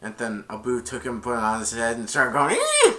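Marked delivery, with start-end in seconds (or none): 2.6-2.9 very high squealing screech; piercing high monkey squeal. Retold or imitated. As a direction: shriek at the carpet like an angry monkey. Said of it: "started screaming at him".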